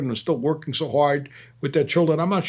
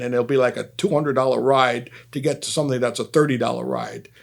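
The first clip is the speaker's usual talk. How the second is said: ordinary talk, nothing marked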